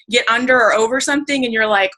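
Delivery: ordinary delivery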